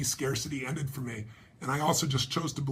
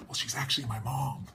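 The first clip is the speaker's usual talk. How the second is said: whispered, hushed correction; a kid's hushed aside to the stranger at lunch; boy's whisper; 3 semitones lower, 4 dB quieter than usual. Retold or imitated. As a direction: let it slip out under the breath, a little embarrassed. Boy quietly corrects the narrator.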